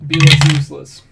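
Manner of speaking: loud mock scolding. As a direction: yell at the character to do better. Urging on his character.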